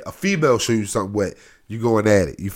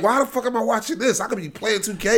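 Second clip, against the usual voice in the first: mock disgust; 9 semitones higher than usual. Acting disgust at the porn.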